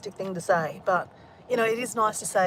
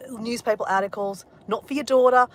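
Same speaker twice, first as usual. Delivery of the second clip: ordinary talk, nothing marked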